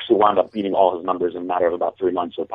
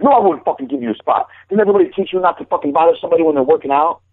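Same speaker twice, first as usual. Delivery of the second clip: put-on higher and fast, like a hothead lifter telling off a kid; retold angry rant; 8 semitones higher, faster than usual. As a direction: replay the gym bully's irritated rant at speed. Hothead lifter refuses a spot.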